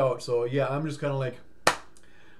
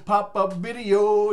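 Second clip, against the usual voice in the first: slow, louder stretched words; drawn-out call; 4 semitones higher, 5 dB louder, much slower than usual. Acted. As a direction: draw it out like a jingle. Mock TV jingle.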